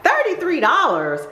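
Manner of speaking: high, stunned question; shocked at the price. Retold or imitated. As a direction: gasp it out in disbelief. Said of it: "I was like".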